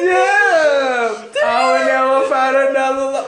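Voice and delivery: mock shout. Retelling singing along emotionally.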